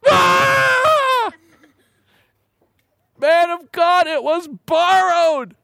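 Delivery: high wailing cry, then slow, desperate pleading; a frightened man shrieks over the lost axe head; panicked cry. Retold or imitated. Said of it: "And the poor guy goes".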